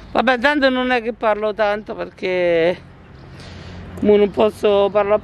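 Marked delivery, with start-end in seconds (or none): none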